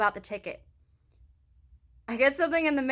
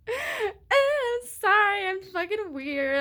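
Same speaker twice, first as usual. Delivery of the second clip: whiny apology; 9 semitones higher, slower than usual. Retold or imitated. Mimics apologizing at gas station.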